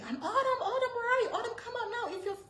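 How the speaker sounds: very high, loud mock scream of the name; imitating husband screaming. Retelling the husband screaming for the child, imitated very high. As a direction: throw his frantic call out in a hammed-up high voice. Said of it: "he starts screaming".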